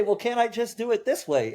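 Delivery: high, mock-whiny client asking for a shortcut. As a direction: play the stubborn client who wants an easier way. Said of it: "they say".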